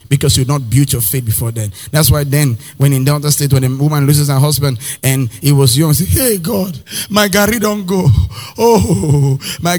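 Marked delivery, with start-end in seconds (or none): 6.0-9.8 high mock lament to God; wailing widow. Preacher mimicking a young widow wailing, high. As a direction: cry out like a grieving Delta widow.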